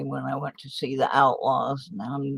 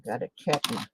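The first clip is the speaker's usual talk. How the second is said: ordinary talk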